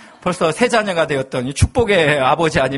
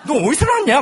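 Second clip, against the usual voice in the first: scolding; 7 semitones higher, 3 dB louder than usual. Retold or imitated. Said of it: "구박을 많이 했어요".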